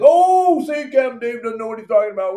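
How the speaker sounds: high, loud, fast gloating friend; hammed-up know-it-all crowing; mock boast from a friend who got lucky. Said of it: "Now you're like".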